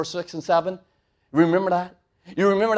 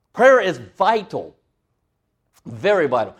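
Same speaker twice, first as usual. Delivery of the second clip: slow emphatic; 3 dB louder than usual. Real, not acted. Preaching emphasis.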